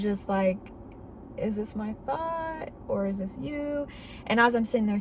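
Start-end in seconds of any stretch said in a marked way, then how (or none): 1.4-3.9 a stunned hush to God; hushed, unsure question. Retold or imitated. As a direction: ask it in quiet awe, pitch lifted. Asking God in shock.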